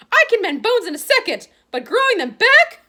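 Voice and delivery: high, loud, outraged nurse; indignant matron. A narrator's reading. Matron appalled at the boneless arm, loud and high. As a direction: let the last words stretch in disbelief.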